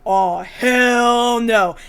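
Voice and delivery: long stretched-out mock refusal; slow, comic "no way"; emphatic refusal. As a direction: draw it right out in refusal. Acting Umibozu's refusal.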